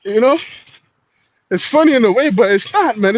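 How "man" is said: ordinary talk, nothing marked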